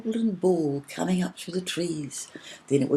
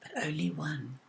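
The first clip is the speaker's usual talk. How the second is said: softer, hushed and gentle; the cloud gently warning the fairy; soft little voice; 10 dB quieter than usual. A narrator's reading. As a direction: say it softly, like a kind cloud. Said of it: "in a soft little voice".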